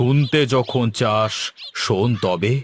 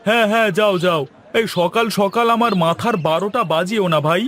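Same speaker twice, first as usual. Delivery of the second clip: higher, exasperated brush-off; irritated shooing; 8 semitones higher than usual. Acted. Grocer shoos the servant off to the toy shop, exasperated. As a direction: wave him off, fed up.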